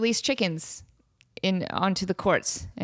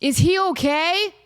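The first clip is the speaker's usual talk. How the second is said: mock concerned dude; 8 semitones higher, 4 dB louder, slower than usual. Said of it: "he's like".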